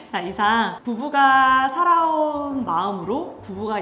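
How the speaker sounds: loud, high, drawn-out closing announcement; stretched-out, upbeat show closer; rousing sign-off. Real, not acted. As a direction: call out the outro slowly and big.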